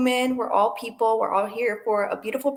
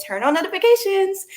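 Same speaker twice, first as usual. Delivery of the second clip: YouTuber voice; 5 dB louder than usual. Pretend. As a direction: pump it up like a YouTuber. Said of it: "I'm gonna turn on my YouTuber voice and say".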